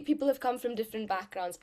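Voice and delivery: ordinary talk, nothing marked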